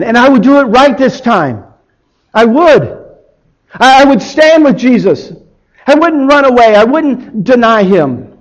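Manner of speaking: high, forceful and pleading; impassioned vow. Acted. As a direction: vow it with desperate conviction. Peter wishes to redo the moment.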